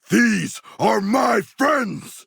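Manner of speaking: very high, very slow outraged shout; protective shout. Acted. Shark warrior to enemies, very high slow protective shout. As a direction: roar it out to guard your friends. Defending squad in combat.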